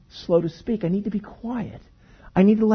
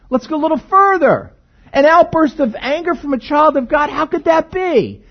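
higher, incredulous questioning; mock surprised objector; 7 semitones higher than usual. Pastor voicing a shocked congregant, higher pitch. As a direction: play the doubting listener, voice raised. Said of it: "Hold it!".

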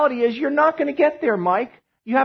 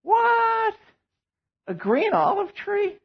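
high, shocked cry of disbelief; mock outrage, pitched way up; incredulous; 9 semitones higher, 3 dB quieter, much slower than usual. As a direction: yelp it like you can't believe it. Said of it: "and I go".

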